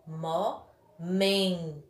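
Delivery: "momento" very slow, mo, long MEN, to; stressed syllable demo. Shown as a demonstration. Teacher shows MEN is tonic, "momento" slowly split. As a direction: pull "momento" apart, dwelling on MEN. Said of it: "men é a sílaba tônica".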